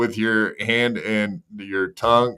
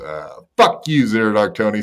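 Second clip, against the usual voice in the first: ordinary talk, nothing marked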